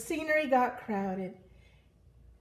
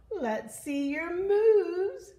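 high, loud, stretched-out call; playful Pig hollering across a pond; 10 semitones higher, 6 dB louder, much slower than usual. Acted. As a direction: draw it out, rising and loud. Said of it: "called out".

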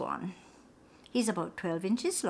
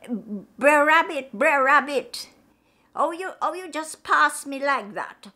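higher and louder, calling for help from under a brick; snake calling out; 6 semitones higher, 8 dB louder than usual. Acted. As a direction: plead loudly like a trapped snake, reproachful. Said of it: "he heard a voice".

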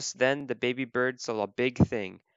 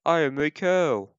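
put-on cow voice, higher and louder; cow character; 4 semitones higher, 6 dB louder than usual. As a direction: play an emphatic storybook cow. Cow answers baby bird in story.

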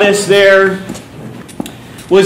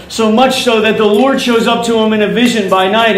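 ordinary talk

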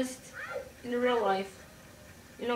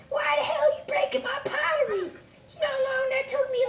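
high-pitched squealed outrage; very high cartoon complaint; angry Link voice; 4 dB louder than usual. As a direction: screech it in a shrill falsetto, indignant. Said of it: "Symbol Link comes out".

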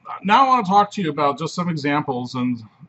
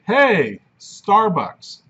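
Hey, Starbucks called out loud and high; 6 semitones higher, 6 dB louder, much slower than usual. Shown as a demonstration. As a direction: call out Hey, Starbucks like spotting it from the car. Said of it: "and I say".

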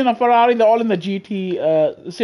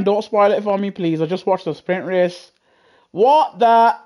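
ordinary delivery

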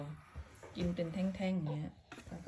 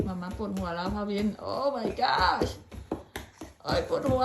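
mock dizzy whine; 6 dB louder, much slower than usual.

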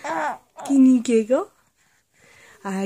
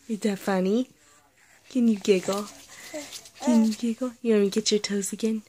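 ordinary talk, nothing marked

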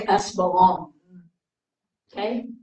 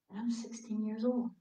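unvoiced whisper to the audience; confiding her age in a whisper; whispered aside; 14 dB quieter than usual. Real, not acted. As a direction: lean in and whisper it.

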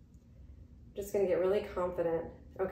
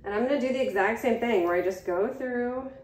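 normal talking voice

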